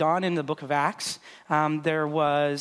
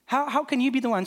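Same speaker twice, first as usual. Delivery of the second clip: bystanders' disbelief; 8 semitones higher than usual. Retold or imitated. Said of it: "people were looking on being like".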